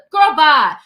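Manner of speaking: loud, sharp brush-off; dismissing the doctor's complaint. Real, not acted. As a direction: wave her off out loud.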